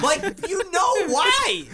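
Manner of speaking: very high, strained, drawn-out yell; exasperated yell. Retold or imitated. Repeating the accusation to the roommate, escalated exasperated yell.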